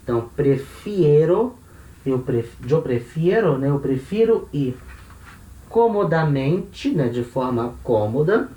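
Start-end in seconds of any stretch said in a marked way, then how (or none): 0.0-1.5 prefiero with the stressed E turned to IE, pre-FIE-ro; stem change shown. Shown as a demonstration. Teacher spelling out prefiero's ie stem change. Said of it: "a vogal E da raiz vira I E". 5.7-6.9 comodamente drawn out slowly, stress kept on có; accent shown. Shown as a demonstration. Teacher showing where comodamente keeps its accent. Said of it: "com esse acento aqui esquisito".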